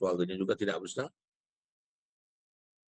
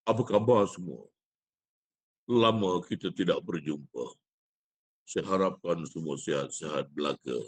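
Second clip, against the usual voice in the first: deep, puffed-up greeting, lower in pitch; exaggerated booming voice; 6 semitones lower than usual. Shown as a demonstration. Teacher demonstrating an inflated big voice to poetry students. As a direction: inflate the voice to sound big and echoing. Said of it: "Besar, bergema".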